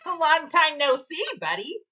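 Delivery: cocky upbeat hero line; Sonic energy. Acted. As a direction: say it with cocky swagger. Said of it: "Sonic the Hedgehog energy with the way you read that line".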